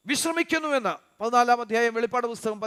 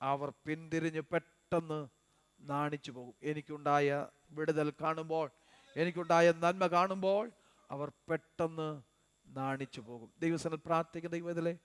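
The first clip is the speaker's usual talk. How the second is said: lower, softer, intimate sermon voice; soft pastoral exhortation; 6 semitones lower, 11 dB quieter than usual. Acted. Pastor reassuring the gathered believers, soft and low. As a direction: bring it down and comfort the faithful.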